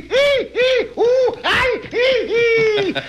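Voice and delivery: very high, squealing hee hee; senorita's scream. Retold or imitated. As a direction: shriek like a frightened lady. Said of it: "And the senorita scream".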